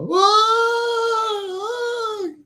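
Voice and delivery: high wailing whoa as the ship is hit; Star Trek alarm cry. Pretend. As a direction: yelp like actors rocked by an attack. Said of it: "and we're like".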